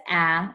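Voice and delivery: "ah" said open and short, like the a in apple; short a sound. Shown as a demonstration. Teacher showing the class the short A, a clear "ah". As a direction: give the pure short "ah" of apple. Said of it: "So we know A says".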